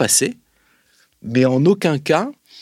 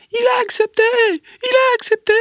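very high, loud mock yell of triumph; excited shout; 5 dB louder than usual. Embassy spokeswoman bursting out of the room, shrieking with glee. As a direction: shriek it out like a thrilled official running from the room. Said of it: "elle sort en hurlant de la pièce".

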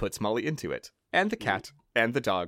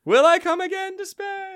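falsetto; 5 dB louder than usual. Acted. Falsetto joke.

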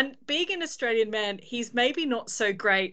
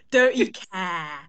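slow, careful try at London; learner's slow London accent; attempted London accent; 4 dB louder, slower than usual. Shown as a demonstration. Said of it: "I'm gonna try this out here".

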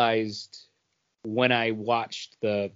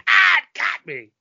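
much higher, louder mock cry of being hit; mock hit kid; 6 dB louder than usual. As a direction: ham up getting whipped by the toy. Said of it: "you'd be like".

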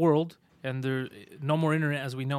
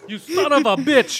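slow, loud outraged cry; hammed-up betrayed yell; mock outrage; 9 semitones higher, 9 dB louder, slower than usual.

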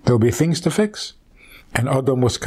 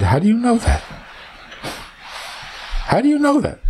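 much higher, disbelieving Adam; incredulous; 10 semitones higher, faster than usual. Acted. Adam doubting Chava, incredulous and higher. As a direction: ask it twice in rising disbelief.

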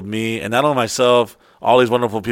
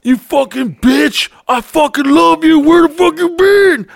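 much higher, louder and slow, half crying half yelling; hysterical young lover; 7 dB louder, slower than usual. Retold or imitated. Young boyfriend screaming at his girl, shrill mock meltdown. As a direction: shriek it like a jealous teenager. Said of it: "you're allowed to, like, fucking".

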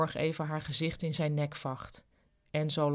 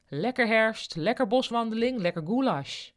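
enthusiastic pitch; 5 semitones higher, 3 dB louder than usual. A narrator's reading. Enzo hypes his goulash.

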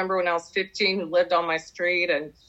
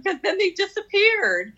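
squeaky high; 12 semitones higher than usual. Real, not acted. Rueful punchline about ex vanishing.

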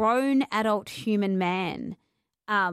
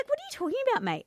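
incredulous; 13 semitones higher, much faster than usual. Retold or imitated. Retold incredulous retort.

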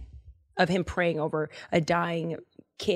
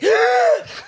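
loud gasp; 7 dB louder than usual. Acted. Boy revives, gasping.

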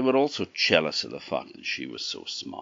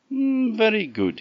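high, pleased king's approval; a delighted old king, well satisfied; a lightly voiced king, pleased; 6 dB louder than usual. A narrator's reading.

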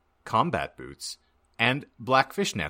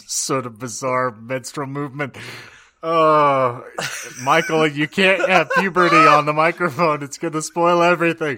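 laughing; 5 semitones higher, 8 dB louder than usual. Real, not acted. Host breaks up laughing.

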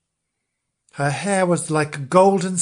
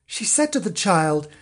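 ordinary delivery

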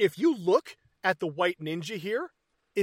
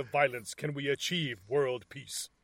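mock solemn narrator; 5 semitones lower than usual. Reading the end card solemnly.

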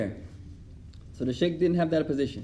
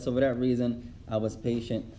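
calm sheikh; 6 semitones lower, 5 dB quieter than usual. Retold or imitated. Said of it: "He said".

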